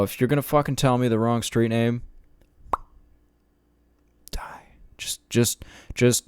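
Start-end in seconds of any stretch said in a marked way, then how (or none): 4.3-5.3 whispered threat. Pretend.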